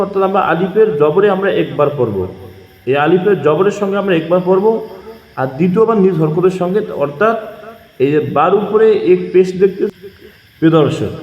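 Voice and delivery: ordinary talk, nothing marked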